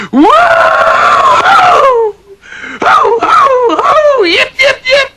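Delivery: very high, loud yelping yee-haw whoops; shrill shouted cowboy yips. Retold or imitated. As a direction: whoop like a rodeo rider swinging a lariat. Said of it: "and he yells".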